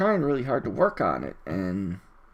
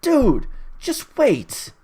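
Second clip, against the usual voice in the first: high, very drawn-out exasperated retort; pleading, pitched-up exasperation; 10 semitones higher, much slower than usual. Real, not acted. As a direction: stretch each word in disbelief. Exasperated retort to the mocked complainers.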